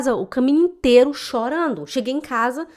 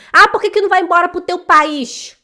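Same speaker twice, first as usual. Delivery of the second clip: higher, louder sneering taunt; mocking taunt; 6 semitones higher, 8 dB louder than usual. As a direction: jeer like a spiteful local. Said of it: "É isso que os infelizes vão falar".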